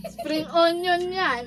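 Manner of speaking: slow, loud, teasing correction; correcting. Real, not acted. Corrects partner after "Bobo.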